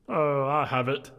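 high, loud put-on young gentleman; merry nephew. Nephew telling guests about his comical uncle, high loud voice. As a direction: pitch it high and project. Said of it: "said Scrooge's nephew".

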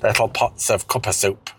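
normal talking voice

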